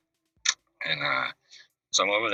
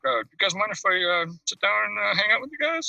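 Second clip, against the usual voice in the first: much higher, mock rock-star greeting; imitating a rock singer; 13 semitones higher than usual. Rock star walks into the house, greets him in a high mock voice. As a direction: pitch the greeting way up like a famous singer.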